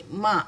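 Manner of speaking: "Ma." said loudly as one syllable; loud model syllable "Ma."; Tamil letter sound. Shown as a demonstration. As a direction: announce "Ma." firmly as the model. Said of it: "bukan M A".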